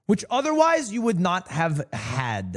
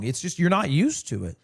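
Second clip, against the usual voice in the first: ordinary talk, nothing marked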